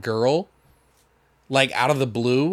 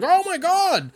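mock gasp; slower than usual. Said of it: "He's like".

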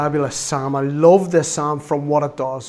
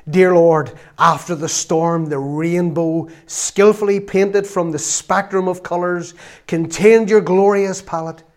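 slow, grandly poetic mock prayer; elaborate prayer; 2 semitones higher, 4 dB louder, slower than usual. Preacher imitating the wonderful prayers he would hear, flowery and elaborate. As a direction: imitate a flowery prayer, drawing out each phrase. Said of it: "You would hear such wonderful prayers like".

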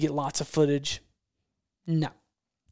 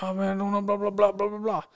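higher, babbling mimicry of a reluctant hunter; mock protesting hunter; 4 semitones higher than usual. Hunter grumbling at being told to cut, mock babbling protest. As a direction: babble the complaint in a put-on higher voice. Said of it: "He's like".

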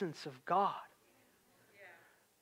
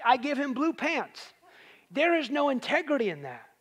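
usual speaking voice

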